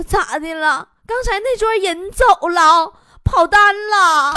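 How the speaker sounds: loud, drawn-out crying question; mock tearful wail. Waiter to diners who look like strangers, mock wailing. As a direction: wail it out in comic despair. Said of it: "哭着就问呐".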